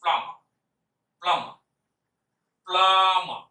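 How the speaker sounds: "প্লামা" said firmly, silent b and no final r; teacher models the right way: "প্লামা" with silent b; correct pronunciation. Shown as a demonstration. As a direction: model "প্লামা" loud and clear, two syllables. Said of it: "এবারে আপনি উচ্চারণ করুন".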